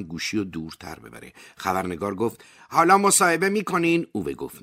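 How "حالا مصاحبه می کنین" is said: high, loud, pushy reporter voice; eager journalist. A narrator's reading. Journalist on the phone pressing Ove, high and loud. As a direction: push for the interview, high and bright-eyed.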